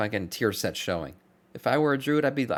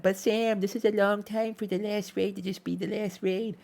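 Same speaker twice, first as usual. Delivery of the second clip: high whiny complaining fan; 11 semitones higher than usual. Retold or imitated. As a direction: imitate a whining fan, pitched up. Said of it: "everyone's like".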